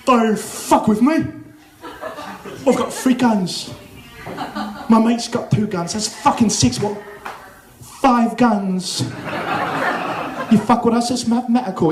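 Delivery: hammed-up British hard-man accent; British gangster accent. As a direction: threaten like a British movie gangster. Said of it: "gangster British Guy Ritchie movie thing".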